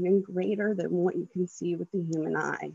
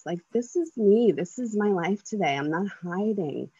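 ordinary talk, nothing marked

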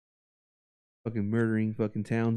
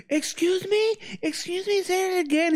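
very high, outraged squeak; mock indignant woman. Imitating the neighbor lady daring him, high and indignant. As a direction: dare him to repeat it, hammed-up.

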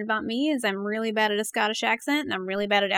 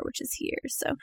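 whispered aside; 12 dB quieter than usual. Real, not acted. Whispered aside about the handle.

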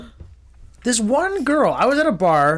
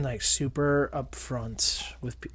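whispering; 4 semitones lower, 9 dB quieter, slower than usual. Real, not acted. Whispered aside.

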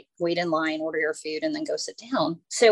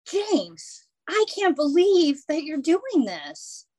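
hurt, accusing lady; 7 semitones higher, 3 dB louder, slower than usual. Retold or imitated. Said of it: "then the lady goes".

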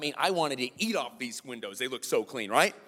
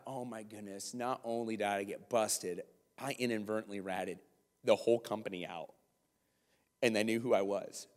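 low, soft sinking realization; subdued, crestfallen retold thought; ashamed; 5 semitones lower, 6 dB quieter than usual. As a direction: let the shame sink in, quietly. Retold shame after being exposed.

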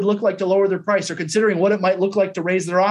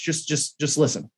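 normal talking voice

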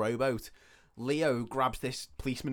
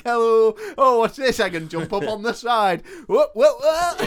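high, very loud hammed-up holler; clownish calling out; 9 semitones higher, 11 dB louder than usual. Prankster waving from the top of the boat, clownish calling out. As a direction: call out playfully to people far below.

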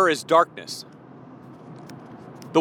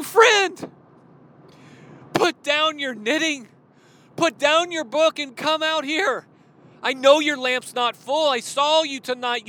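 urgent pleading; 10 semitones higher than usual. Acted. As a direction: rouse the friend, then beg her to fill her lamp. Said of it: "Martha yells back".

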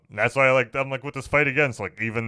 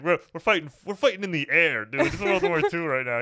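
mock exasperated boss; 9 semitones higher than usual. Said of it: "it's like".

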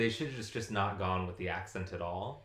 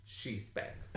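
conspiratorial whisper; whispered tease; 9 dB quieter than usual. Real, not acted. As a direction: whisper it like a naughty secret. Saucy aside about Joyce.

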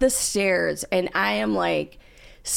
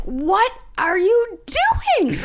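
shocked high cry; 13 semitones higher, 4 dB louder than usual. Retold or imitated. Wife retelling seeing her husband on the stairs, shocked. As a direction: gasp it out in disbelief. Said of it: "and I'm like".

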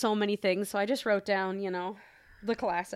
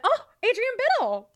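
excited; 13 semitones higher, 5 dB louder than usual. Retold or imitated. Retelling recognizing a name.

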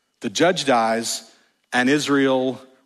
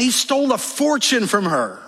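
ordinary delivery

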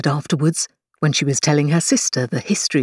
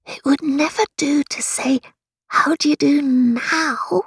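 Alice voice; 9 semitones higher than usual. A narrator's reading.

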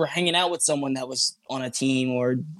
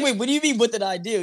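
confused; 6 semitones higher, faster than usual. Retold or imitated. Confused reply to Alex.

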